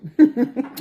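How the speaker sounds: short real laugh. Real, not acted. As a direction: burst of giggling. Laughing at own joke.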